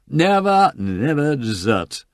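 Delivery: high then slow tipsy insistence; cling on, protesting; drunken protest. Acted. Lord Peter protests from the step, tipsy.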